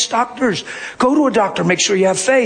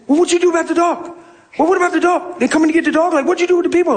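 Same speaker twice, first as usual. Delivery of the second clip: higher, fast Southern questions; excited Southern man; 9 semitones higher, faster than usual. Retold or imitated. Maintenance man quizzing him about the dog, high fast Southern accent. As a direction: pile the questions on in his eager Southern voice. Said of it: "he got Southern in him".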